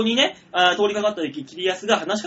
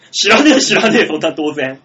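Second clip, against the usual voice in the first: 大声で言い返す再現; 相手の理屈を勢いよく切り捨てる; 突っぱねる怒声; 2 semitones lower, 9 dB louder than usual. Retold or imitated. 言い訳を突っぱねる会話の再現. (声を張った荒い言い返し)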